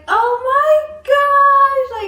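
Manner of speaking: high, loud, drawn-out squeal of pride; overjoyed outcry. Retold or imitated. Proud sister seeing her brother graduate on TV, overjoyed outcry. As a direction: stretch every word into a big, high cry. Retold reaction to brother's name being called.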